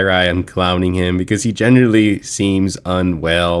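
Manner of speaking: usual speaking voice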